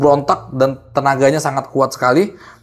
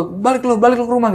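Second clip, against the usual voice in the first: much higher, mock yelled order to go home; half shouting; 9 semitones higher than usual. Sending the girl back home, half shouting. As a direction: chase her off with a raised voice. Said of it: "Jadi agak teriak-teriak juga".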